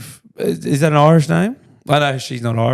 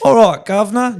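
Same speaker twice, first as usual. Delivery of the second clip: mock English accent; 8 semitones higher, 5 dB louder than usual. Mocking English caller's accent.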